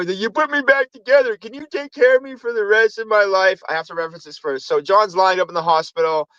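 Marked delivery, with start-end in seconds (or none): 0.2-3.6 high-pitched put-on voice; hammed-up helpless pleading, pitched up; pretending to be damaged. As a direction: play messed up in a high mock voice. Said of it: "he pretended he was, like, all fucked up".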